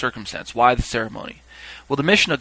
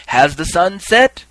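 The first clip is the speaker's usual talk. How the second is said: slow, higher, louder called question; calling out; 6 semitones higher, 7 dB louder, slower than usual. Retold or imitated. Reaper asking the gathered people, calling out. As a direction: call out the question, pitch rising. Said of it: "cry out again".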